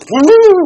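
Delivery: loud, high "woo" of a bird taking flight; startled bird whoop. Retold or imitated. Bird spooked by a hand clap, sudden high whoop.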